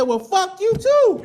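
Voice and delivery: high, slow, laughing retort; laughing comeback. Retold or imitated. Patient snapping back at rude nurse, laughing. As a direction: throw it back at her half joking.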